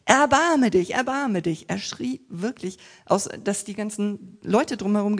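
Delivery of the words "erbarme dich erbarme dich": loud, higher cry for mercy; mock shout. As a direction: cry out as loud as a beggar can. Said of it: "schrie er so laut er konnte".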